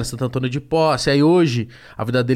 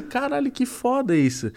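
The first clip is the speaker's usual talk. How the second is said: imitating crying; 9 semitones higher, 3 dB quieter, slower than usual. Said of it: "ele começou a chorar".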